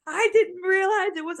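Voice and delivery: high-pitched pained protest; mock crying in pain. Wife mimics husband crying of pain, high mock-tearful voice. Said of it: "he's crying of pain".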